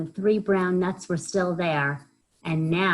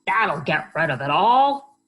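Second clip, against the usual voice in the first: a louder, eager piggy voice; 3 semitones higher, 7 dB louder than usual. Acted. Pig cheerfully urging Hedgehog to roll, eager piggy voice. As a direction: egg her on as jolly Pig.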